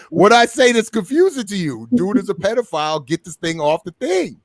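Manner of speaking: high-pitched, exasperated mock telling-off; retold indignation. Mother telling them to stop the music, exasperated. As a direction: lay down the law, retelling it.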